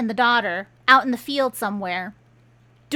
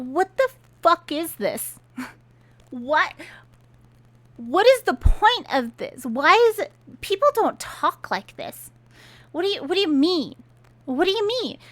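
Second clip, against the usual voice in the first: higher-pitched, incredulous protest; exasperated bafflement; 5 semitones higher than usual. Real, not acted. Reader objecting to an absurd villain scene, exasperated. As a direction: demand an explanation, utterly baffled.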